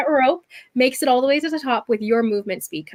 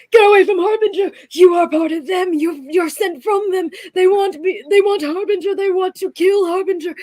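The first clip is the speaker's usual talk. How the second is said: high, loud accusing yells; a terrified creature yelling at the intruders; paranoid shouting; 8 semitones higher, 4 dB louder than usual. Acted. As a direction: scream it out in paranoid fear.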